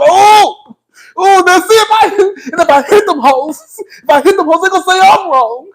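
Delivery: high-pitched mock fury; hammed-up livid squealing; Kandi. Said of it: "bitch, Kandi was livid".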